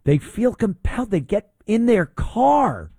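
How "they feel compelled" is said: normal talking voice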